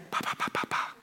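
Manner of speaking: unvoiced breathy puffs like a toddler whispering; just breath, no voice, pa pa pa; toddler's whisper imitated as puffs of air. Shown as a demonstration. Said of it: "all it is is breath".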